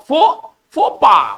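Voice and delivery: फोपा said slowly, syllable by syllable, फो drawn out; French pronunciation. Shown as a demonstration. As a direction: sound out फो... फोपा slowly for the class. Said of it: "लेकिन पढ़ेंगे फो". (फोपा धीरे-धीरे, फो को खींचकर)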